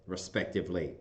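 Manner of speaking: usual speaking voice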